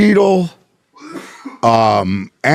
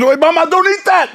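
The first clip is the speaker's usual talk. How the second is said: much higher, squeaky warning; high panicked warning; 13 semitones higher than usual. Retold or imitated. Friend warning his wife about weed chocolate, high and squeaky. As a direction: shriek it like a startled friend. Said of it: "He goes".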